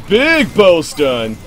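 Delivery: loud, high, drawn-out cheer; real excitement. Real, not acted. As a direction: whoop and stretch out the big hit. Big play lands in game.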